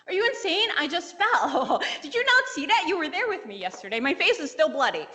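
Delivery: high, swooping mock outrage; incredulous protest. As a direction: protest like he has lost his mind. Retelling incredulous quarrel with boyfriend.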